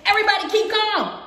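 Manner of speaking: high-pitched comic outcry; playful frantic announcement; mock panic. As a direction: call it out high, as if things got hectic. Acted outcry in hectic story moment.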